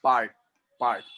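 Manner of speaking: "Part" said alone, short and falling; stressing part. Shown as a demonstration. Teacher explains partitive comes from part, word stressed. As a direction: set apart the word "part" crisply. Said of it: "ये आया है part से".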